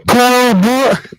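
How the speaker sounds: loud, high, drawn-out scream ending abruptly; scream, then cut "Busch!" off dead; perfectly cut scream. Retold or imitated. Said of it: "perfectly cut scream right there".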